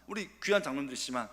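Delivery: ordinary talk, nothing marked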